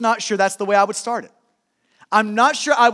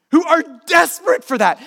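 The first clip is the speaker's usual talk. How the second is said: higher, raised preaching cry; desperate intensity; 5 semitones higher, 4 dB louder than usual. Real, not acted. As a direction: push it out with urgent emphasis. Preacher stressing desperate hunger for God.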